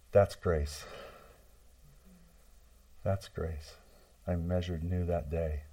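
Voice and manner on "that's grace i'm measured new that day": much softer echo of the line; softly repeated. Shown as a demonstration. Preacher repeating the point to the congregation, softer echo. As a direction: let it sink in, soft.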